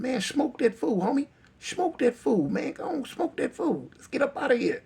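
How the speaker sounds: high, urgent sidekick voice; egging-on homeboy. Retold or imitated. Homeboy telling the gunman to shoot, goading high. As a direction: imitate a buddy goading from the side. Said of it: "his other homeboy telling him".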